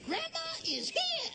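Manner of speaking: high, sing-out grandma greeting; excited arrival. Acted. Grandmother making her entrance to the family, excited high call. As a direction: announce yourself with a big high flourish.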